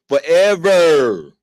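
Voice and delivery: a long, drawn-out Forever, hit loud; loud slow punchline. Acted. To the hosts about simps' endless wait: loud, slow Forever. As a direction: land it as the final exaggeration.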